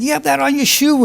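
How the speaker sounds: imitated high-pitched accusation; upset son. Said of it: "how upset he was".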